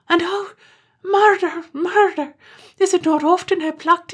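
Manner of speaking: high, loud cry of murder; anguished wail. Acted. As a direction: wail as if the sky is falling. Terence wails over plucking father.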